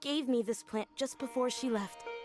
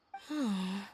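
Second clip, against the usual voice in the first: voiced sigh on waking; 3 semitones lower than usual. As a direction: let out a sigh as you wake. Waking from a dream.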